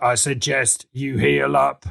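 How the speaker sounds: slow, lightly put-on character voice; measured advice in a lightly altered voice; Dutch Nuggets. Game master voices a sailor advising rest, slow character voice. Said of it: "Dutch Nuggets is like".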